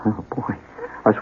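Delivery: usual speaking voice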